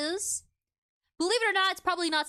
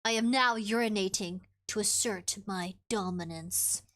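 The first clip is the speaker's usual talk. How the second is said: deadpan; 7 semitones lower than usual. Retold or imitated. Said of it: "I'll say with a straight face".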